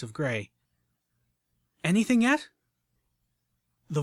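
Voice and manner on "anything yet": sharp higher query; crisp questioning mare; older mare voice. Acted.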